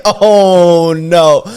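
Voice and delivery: high, loud, laughing horror; laughing dismay. Retold or imitated. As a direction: laugh through the realization. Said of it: "And I'm like".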